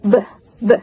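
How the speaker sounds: "V" as a short buzzing "vuh"; voiced V. Shown as a demonstration. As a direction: demonstrate the sound "V", teeth on lip, voice on. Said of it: "turn on your voice".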